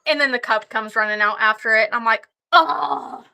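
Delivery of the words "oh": slow, drawn-out exasperated curse; mock frustration. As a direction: drag out the groan of defeat. Cub appears, frustrated groan.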